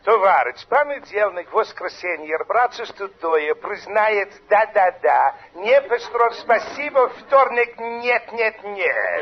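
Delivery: hammed-up fake Russian babble; mock Russian gibberish. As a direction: play a Russian comrade on the phone. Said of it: "stay there in the Kremlin".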